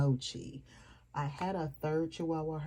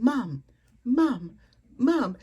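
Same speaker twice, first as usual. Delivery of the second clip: put-on high, loud kid calling Mom; child calling mom; 8 semitones higher, 8 dB louder, slower than usual. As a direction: call out like a little boy wanting his mom.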